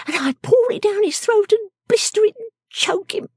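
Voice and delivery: high, relishing character voice; vengeful little shrew. Acted. Miss Jenny imagining revenge on him, high and vengeful.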